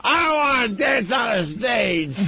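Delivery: high, drawn-out clownish holler; hammed-up yell. Host clowns while the crew readies the stage, hammed-up yell. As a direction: belt it out slowly in a silly put-on voice.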